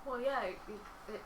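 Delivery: usual speaking voice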